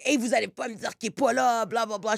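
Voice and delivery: quick Quebec French scolding, lightly imitated; mock Québécois French outburst. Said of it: "She's, like, freaking out".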